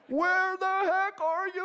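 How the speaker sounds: high, loud, slow yell of a worried parent; upset parent. Retold or imitated. Parents on the payphone.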